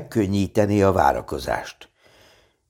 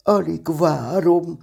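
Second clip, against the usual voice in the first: high, slow, eager bear voice; excited; 9 semitones higher, slower than usual. A narrator's reading. Pooh can hardly wait for the party, excited. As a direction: stretch it out with eager anticipation. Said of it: "mondta Mici Mackó izgatottan".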